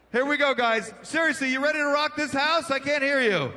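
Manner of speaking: hyped host. Real, not acted. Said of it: "host pumps up crowd".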